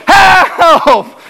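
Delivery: sudden high shout for help; yelling for help. Retold or imitated. Drowning swimmer shouting to a surfer for help. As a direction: yell it out like your head is going under. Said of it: "And I really yell out".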